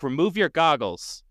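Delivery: high, urgent acted yell; yelled command. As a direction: call out an urgent order like a commanding officer. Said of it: "The C O quickly yelled back".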